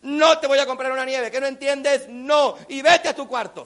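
high, loud telling-off; scolding mother. Retold or imitated. Mom scolds son using both his names, loud and angry. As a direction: shout the refusal like a fed-up mom. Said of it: "te pone los dos nombres para regañarte".

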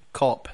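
"cop" with an open ah vowel; vowel contrast demo. Shown as a demonstration. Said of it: "They sound quite similar".

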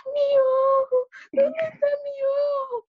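little sister screaming in panic about her eye; panicked child. Retold or imitated. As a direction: wail like a toddler who thinks her eye is gone. Scared little girl searching eye.

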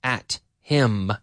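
each word set apart; slow dictation with pauses between words; very slow model sentence. Shown as a demonstration. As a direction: give learners one word at a time.

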